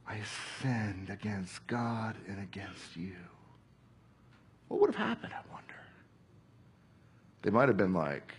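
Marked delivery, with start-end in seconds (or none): 0.0-3.2 tearful hushed whisper, slow; crying. Retold or imitated. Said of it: "and he's crying". 4.7-5.8 softer, higher hushed question; hushed wondering. Shown as a demonstration. Repeated question, now hushed.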